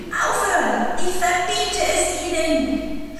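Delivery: high, drawn-out panicky cry; desperate, high-pitched forbidding; panicked shout. A narrator's reading. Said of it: "schrie Onkel Vernon in Panik".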